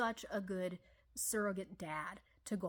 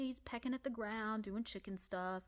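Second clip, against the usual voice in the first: ordinary talk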